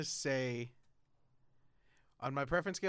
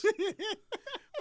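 high laugh. Real, not acted. Laughs at a joke.

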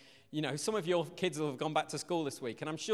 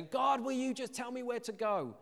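acted pleading; 7 semitones higher than usual. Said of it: "we wanna just kinda say".

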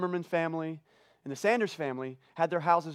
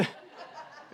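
breathy laugh; 16 dB quieter than usual. Real, not acted. Joke about Burgal.

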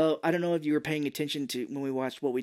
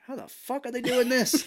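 much higher, baffled disbelief; incredulous; 11 semitones higher, 3 dB louder than usual. Retold or imitated. Speaker reacting to the mystical movie scene, incredulous. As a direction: gawp at the nonsense on screen. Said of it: "now I'm like".

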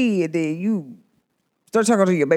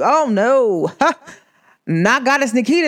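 normal talking voice

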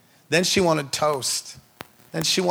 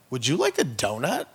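playful swooping, coaxing question; teasing offer; 3 semitones lower, 4 dB quieter than usual. Retold or imitated. Father tempting his daughter in hospital, mock-enticing. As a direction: dangle the offer with a big rise at the end.

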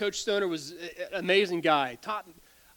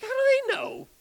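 much higher, incredulous mock question; mock indignation; 5 dB louder than usual. Hurt churchgoer asking in disbelief, mock indignant. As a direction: squeak it out in disbelief.

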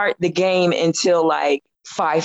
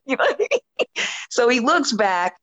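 ordinary talk

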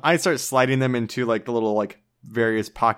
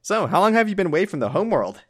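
character voice; 8 semitones higher, 4 dB louder, faster than usual. Acted. Player voices character chatting.

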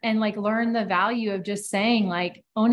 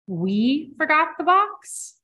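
dry, flat question, slower, rising higher at the end; deadpan firm question; 7 semitones higher, slower than usual. Retold or imitated. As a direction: let her catch the "we", tough but kind. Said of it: "she looked at me deadpan".

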